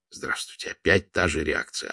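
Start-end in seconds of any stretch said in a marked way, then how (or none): 0.1-0.7 unvoiced, voice dropped away; a deflated whisper to the silent room; whispered greeting. A narrator's reading. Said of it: "Она в третий раз".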